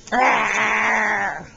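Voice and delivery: loud playful rawr; bear roar. Shown as a demonstration. Showing the toddler a bear roar, loud rawr. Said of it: "Can you say rawr?".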